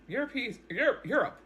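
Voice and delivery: worked-up repeated Europe; excited exclaim. Real, not acted. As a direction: call out Europe in disbelief. Exclaiming about angry Europe.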